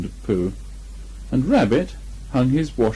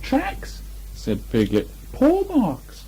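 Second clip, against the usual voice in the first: much higher small Piglet voice; 12 semitones higher, much slower than usual. A narrator's reading. Piglet triumphant to Christopher Robin, high and small. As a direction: squeak it out like a tiny piglet.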